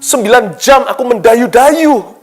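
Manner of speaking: exasperated complaint. Retold or imitated. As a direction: whine about nine hours of rowing. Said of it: "Mungkin kita bisa protes".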